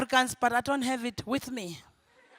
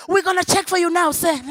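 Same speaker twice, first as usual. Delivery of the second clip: higher, eager service voice; polite receptionist; 8 semitones higher, 8 dB louder than usual. Retold or imitated. Hotel clerk jumping to help the French callers, higher and eager. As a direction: fawn over the caller. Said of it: "the minute they say".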